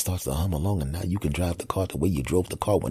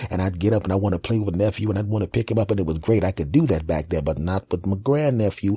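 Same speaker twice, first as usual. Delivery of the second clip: normal talking voice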